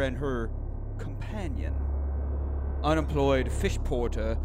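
slow, deliberate reading; true crime narration. A narrator's reading. Host introducing Mary Kelly, slow storyteller narration. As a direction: read it out slowly, pausing between phrases.

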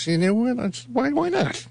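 high, light mock shrug; mock casual shrug. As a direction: sell the why-not. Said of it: "I just said".

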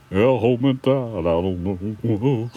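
mock crooner impression, trailing into a slow mumble; half-hearted rock-and-roll singer voice, dragged out at the end. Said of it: "[name]'s accent".

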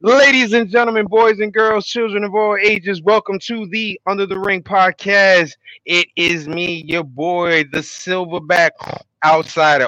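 fast, high showman announcer; ringmaster. Acted. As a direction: hype up the crowd like a ringmaster. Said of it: "ringmaster-style show intro".

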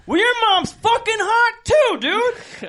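loud, high, drawn-out retort; hammed-up yelling in a silly argument; heated mock comeback. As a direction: fire the insult back at full volume. Retold trash-talk comeback, acted.

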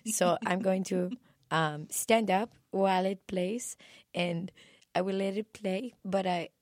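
soft, accented singer impression. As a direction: address the audience softly in a mock singer's accent. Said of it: "she just was like".

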